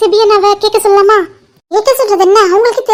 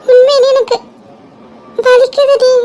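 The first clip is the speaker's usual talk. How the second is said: crying; 3 semitones higher than usual. Acted. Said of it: "நீ அழாத மலர்".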